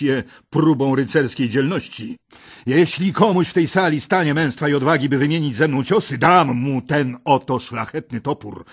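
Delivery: a huge knight mocking the court; booming green knight. A narrator's reading. Green knight's challenge voiced.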